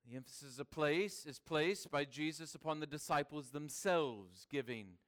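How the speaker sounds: ordinary talk, nothing marked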